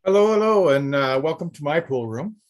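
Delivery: normal talking voice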